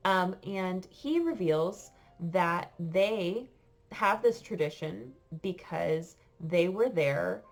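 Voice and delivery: ordinary delivery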